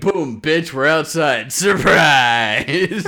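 loud gleeful gotcha; triumphant reveal. Retold or imitated. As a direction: crow it with a laugh. Voicing brother's triumph.

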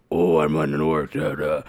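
low, gruff orc growl, imitated; big gruff orc voice. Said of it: "have this big, gruff, like, orc voice".

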